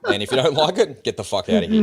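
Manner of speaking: high, loud, laughing brush-off; laughing dismissal. Real, not acted. Podcaster waving off easily offended listeners, laughing dismissively. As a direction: tell them off while cracking up.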